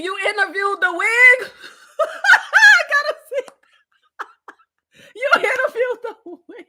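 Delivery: real high-pitched laughing fit through the words; cracking up. Real, not acted. Host reading a viewer's joke about the wig, cracking up. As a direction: barely get it out for laughing.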